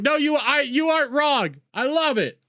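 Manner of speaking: normal talking voice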